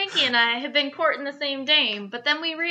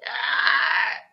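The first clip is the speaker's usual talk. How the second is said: banshee shriek; 3 semitones lower, 6 dB louder than usual. Acted.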